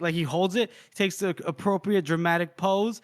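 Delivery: ordinary talk, nothing marked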